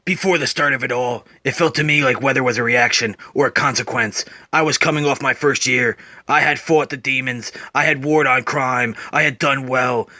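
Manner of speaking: deep, low, brooding Batman voice; Batman narrating. Acted. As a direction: growl it low like a weary vigilante. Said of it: "Then Batman narrates".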